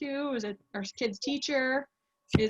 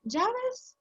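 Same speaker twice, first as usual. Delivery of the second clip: lightly acted host mom, much higher; higher-pitched imitation with zh sound; imitating host mom; 13 semitones higher than usual. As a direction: echo her dialect in a high voice. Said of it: "And she's like".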